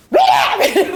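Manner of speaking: angry mother-in-law. Retold or imitated. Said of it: "더 화가 나지고".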